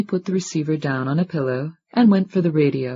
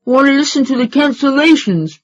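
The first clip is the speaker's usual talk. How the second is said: put-on boy talking through candy; mouth full; 11 semitones higher, 7 dB louder than usual. A narrator's reading. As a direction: speak round a mouthful. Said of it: "talked with his mouth full".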